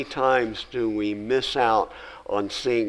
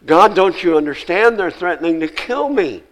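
pleading Elijah; 5 semitones higher, 7 dB louder than usual. Acted.

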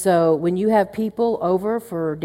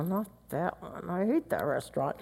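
much softer mock grumble; muttered complaint; 10 dB quieter than usual. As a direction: grumble it under your breath, half swallowed. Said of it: "We complain or we murmur under our breath".

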